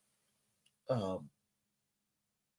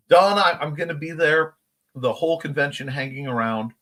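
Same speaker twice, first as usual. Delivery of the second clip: ordinary talk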